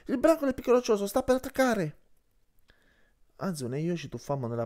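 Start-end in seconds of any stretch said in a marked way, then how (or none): 0.1-2.0 high, loud alarmed shout of a name; anxious call. Acted. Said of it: "La sua voce era colma d'ansia".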